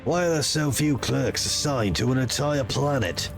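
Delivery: loom over him with cold authority; stern commander voice. Acted. Player character voice.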